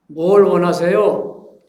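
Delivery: loud, drawn-out demanding question; retold shout. Young pastor silently protesting to a dissatisfied worshipper, retold as a shout. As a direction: cry it out slowly and loudly at the listener. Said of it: "그분을 향해서 제가 소리쳤습니다".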